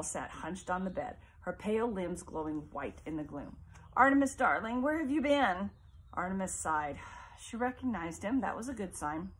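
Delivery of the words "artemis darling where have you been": a lightly voiced mother, higher and louder; fragile mother. Sick mother greeting her son, lightly voiced. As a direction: call out to her son, sweetly.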